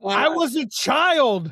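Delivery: high, drawn-out mock whine; indignant protest. Real, not acted. Podcaster defending childhood self from the nerd jibe, indignant protest. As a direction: stretch it out, high and aggrieved.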